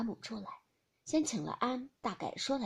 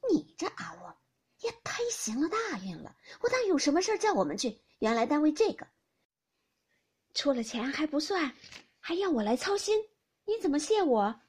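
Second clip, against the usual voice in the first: higher, louder, brisk teasing lady; teasing Youshi; 6 semitones higher, 4 dB louder than usual. Acted. Youshi teasing Fengjie about the money, higher and louder. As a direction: needle her friend playfully, voice lifted.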